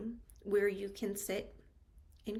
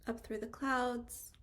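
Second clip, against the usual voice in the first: unhurried meditation guide, long pauses between phrases; calm, spacious meditation delivery with lingering pauses; guided meditation; 3 dB quieter than usual. Real, not acted. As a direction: guide them into stillness, phrase by phrase.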